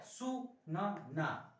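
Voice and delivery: सु न ना split into very slow separate syllables; very slow सु न ना sounding out for children. Shown as a demonstration. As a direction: spell out सु न ना slowly. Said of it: "ये ध्यान रखिएगा आप लोग".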